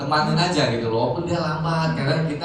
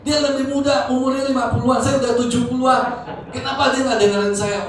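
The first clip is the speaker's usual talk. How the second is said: high-pitched indignant rant, acted out; mock tirade, voice pushed way up; retold angry father; 13 semitones higher than usual. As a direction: imitate a father fuming at being disrespected. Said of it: "dia marah-marah".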